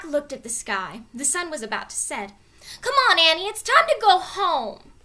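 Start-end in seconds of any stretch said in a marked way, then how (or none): 2.8-4.9 loud high call to his sister; yelling. A narrator's reading. Jack calling Annie home from the road, yelling. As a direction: call her home across the woods. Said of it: "yelled Jack".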